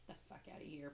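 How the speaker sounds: hushed stunned aside; muttered disbelief. Retold or imitated. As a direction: say it under your breath. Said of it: "I was like".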